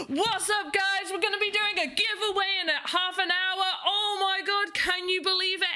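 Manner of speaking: high, fast hammed-up American hype host; over-the-top American streamer screaming for chat hype; inner American hype. British streamer mocking American hype to chat, high and hammed-up. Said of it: "channel my inner American".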